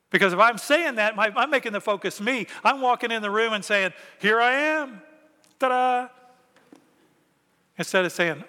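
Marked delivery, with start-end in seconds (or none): 4.2-6.1 showy entrance. Pretend. Self-focused churchgoer entering.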